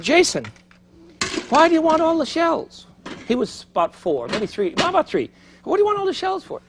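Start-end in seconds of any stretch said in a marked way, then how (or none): 0.0-2.9 put-on high, coaxing voice for a little boy; father asking his small son. Father to his three-year-old son, high and coaxing. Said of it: "And I said". 5.6-6.6 put-on high, coaxing voice for a little boy; father asking his small son. Father to his three-year-old son, high and coaxing. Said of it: "And I said".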